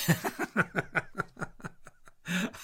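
airy wheezing laugh at himself; a rueful unvoiced chuckle; breathless laugh. Real, not acted. Man laughing at his own blown-out voice, airy chuckle.

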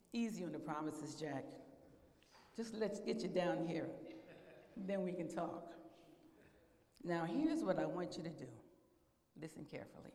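calm him down patiently from above; voice of the Lord. Acted. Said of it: "I'm the Lord".

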